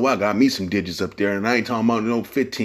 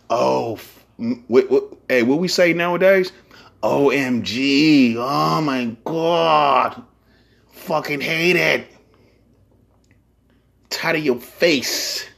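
slow, higher whining groan; mock whiny worker; 4 semitones higher, slower than usual. Mocking a fed-up worker, slow and whiny. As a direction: drag it out like a fed-up clerk.

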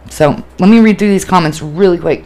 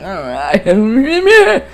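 drawn-out, strained wail, imitating a sob; hammed-up blubbering whine; mock ugly crying; 3 semitones higher, much slower than usual. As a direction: wail the words like an ugly cry. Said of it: "ugly crying. I was like".

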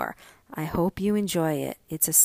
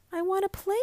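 put-on pleading boy; 12 semitones higher, faster than usual. A narrator's reading. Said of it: "Joseph pleads".

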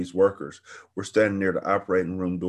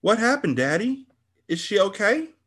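put-on much higher, urgent child's plea; anxious pleading; 13 semitones higher than usual. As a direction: beg Daddy for news. Daughter anxiously asks father.

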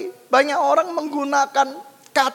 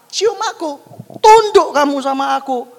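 lightly acted pushy boyfriend, raised pitch and volume; hammed-up demand of a bossy suitor; mock commanding lover; 4 semitones higher, 8 dB louder than usual. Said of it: "teman spesial berkata".

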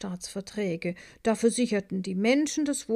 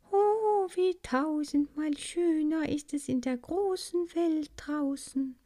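high, soaring oh of wonder; rapturous cry; 7 semitones higher than usual. A narrator's reading. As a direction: cry out in delight. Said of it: "rief sie".

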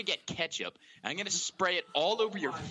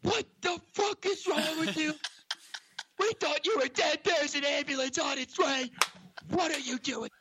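high, strained retold yelling; screaming cop; 12 semitones higher than usual. Rookie cop to kid playing dead, screaming. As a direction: imitate a panicked cop yelling. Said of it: "this cop starts screaming at me".

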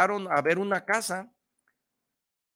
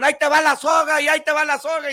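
higher, louder call to a man in the water; 8 semitones higher, 6 dB louder, faster than usual. Retold or imitated. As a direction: shout the offer across the river. Rescuers calling to drowning man.

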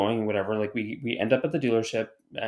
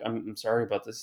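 apologetic; 2 semitones lower, 8 dB quieter than usual. Retold or imitated. Retells the driver's apology.

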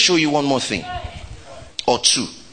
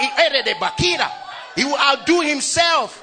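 slow preached reading; 9 semitones higher, much slower than usual. Acted. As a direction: declare it slowly to the church. Leading the church in reading.

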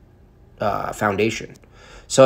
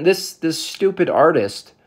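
ordinary delivery

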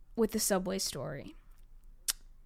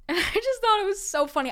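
talking through a real laugh, pitched up; 10 semitones higher, 4 dB louder, faster than usual. Real, not acted. To listeners, laughing about seeing him. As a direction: giggle out the whole line.

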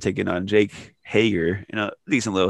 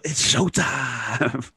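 retold yell; 5 semitones higher, much slower than usual. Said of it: "I literally yelled".

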